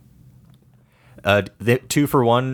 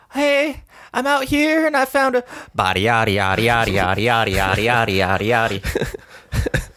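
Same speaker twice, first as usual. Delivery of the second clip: mock [name]; 2 semitones higher than usual. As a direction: babble it like a rapper on a call. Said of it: "went a little something like this".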